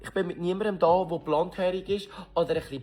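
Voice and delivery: high loud fed-up denial; exasperated denial. Retold or imitated. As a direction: push back in exasperation.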